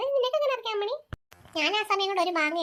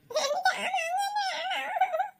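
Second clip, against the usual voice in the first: high, slow wailing demand; crying tantrum; 9 semitones higher, much slower than usual. Acted. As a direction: bawl it like a spoilt child. (വാശിപിടിച്ച് കരയുന്നു)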